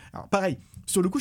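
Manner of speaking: usual speaking voice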